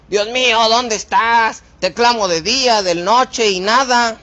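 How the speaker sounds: high, loud, reproachful wail at God; complaining prayer. Shown as a demonstration. Preacher demos a wrong, accusing prayer to God. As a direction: demand it like a grievance. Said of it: "hay formas de decir las cosas".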